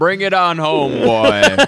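hammed-up drawl calling home; mock coastal dad. Mocking show line.

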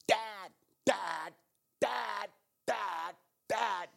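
put-on a child calling dad from bed. Son calling dad at bedtime.

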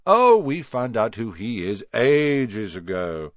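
put-on slow, smug boy's voice; impressive boast. A narrator's reading. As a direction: savour the boast. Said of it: "said Ron impressively".

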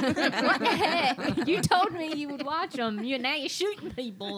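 loud, high, laughing through the scolding; mock outrage cracking up; laughing exasperation. Her reply, laughing.

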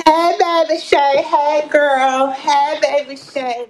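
shouting greetings full volume; hollering hello across the room; loud shout-out. Real, not acted. Streamer hollers greetings to a viewer, loud and drawn out.

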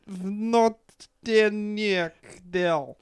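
put-on very slow, deliberate Russian accent. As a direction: spell out each Russian word in a Russian accent. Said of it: "Your Russian accent today is on".

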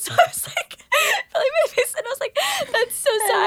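high squeaky laugh-sob; laughing fake crying. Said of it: "seem like the tears were from crying".

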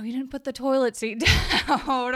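loud high complaint, laughing through it; nagging. Retold or imitated. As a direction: nag about the seat, half laughing. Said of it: "to start nagging at our husbands".

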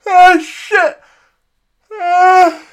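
high, loud yawning exclamation; yawn coming. Real, not acted. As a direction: let the yawn take over the words. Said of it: "I feel a yawn coming on".

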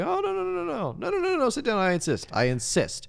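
rapid-fire high no-no-no, settling into insistence; Ray Liotta. Retold or imitated. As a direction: imitate someone brushing off objections, insistently. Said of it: "That was great how he did that".

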